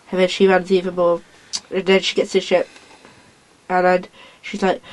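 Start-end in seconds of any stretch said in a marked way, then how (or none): none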